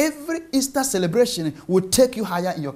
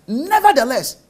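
Nevertheless punched higher and louder; stress on Nevertheless; 4 semitones higher, 5 dB louder than usual. Shown as a demonstration. Preacher showing where Paul shifts the emphasis, stress on Nevertheless. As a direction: hit the word Nevertheless hard and high. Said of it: "he shifts the emphasis".

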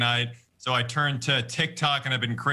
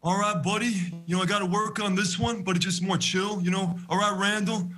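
higher, chill, laid-back big-brother talk; higher, relaxed buddy voice; Milo Ventimiglia impression; 5 semitones higher than usual. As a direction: keep it easy and chill like a cool TV dad. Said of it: "you do a Milo Ventimiglia".

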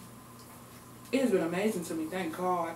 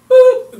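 sudden loud high "boop" sound; high squeaky boop; 16 dB louder than usual. Acted. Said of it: "do my boop more often".

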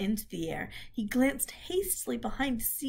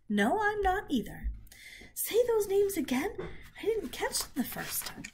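put-on high, young pig voice, pleading; a little pig begging to hear it again; eager piglet; 10 semitones higher than usual. Wilbur's held piglet voice.

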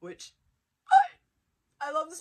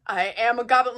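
normal talking voice

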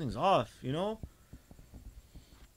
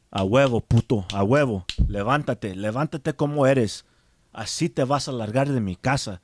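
loud, lower-pitched scolding in Spanish; angry mother's orders; 4 semitones lower, 6 dB louder than usual. Retold or imitated. Mother rousing her child to throw them out, angry and loud. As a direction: imitate a mother ordering someone out.

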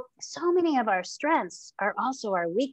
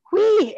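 mock dizzy whee; 12 semitones higher, 10 dB louder than usual. Shown as a demonstration. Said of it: "it's dizzying".

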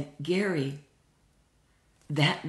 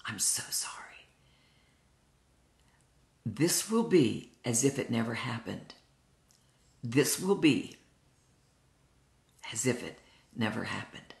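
soft, hushed reassurance with long pauses; tender forgiveness; 3 semitones lower, 4 dB quieter than usual. Retold or imitated. Husband forgiving his weeping wife, tender and hushed. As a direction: quote him gently, letting each promise settle.

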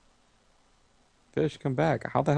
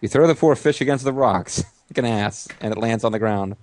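normal talking voice

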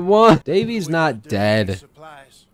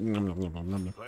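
soft, low nom noises; munching sounds while the character eats; mock munching; 8 semitones lower, 13 dB quieter than usual. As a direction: pretend to chew happily.

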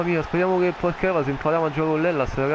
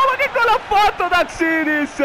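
stunned shout; 13 semitones higher, 4 dB louder than usual. Real, not acted. Repeated line, first take.

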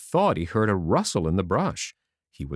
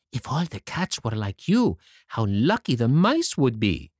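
plain reading voice

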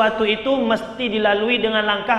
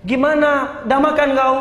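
higher, louder chummy questions; keen friend; 4 semitones higher, 5 dB louder than usual. Acted. Asking an unresponsive friend about eating, eager and friendly. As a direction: press a pal with lively, raised questions. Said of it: "kalau ketemu sama teman".

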